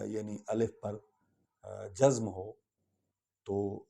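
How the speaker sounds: ordinary talk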